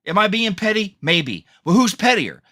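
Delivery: normal talking voice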